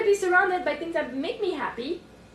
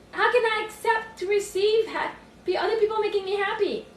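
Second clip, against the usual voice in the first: ordinary delivery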